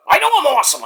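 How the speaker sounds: high-pitched put-on Mexican accent; boastful Mexican-accented character voice; Mike. Mike bragging to the host, high mock Mexican accent. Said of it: "why did I give you a Mexican accent, Mike".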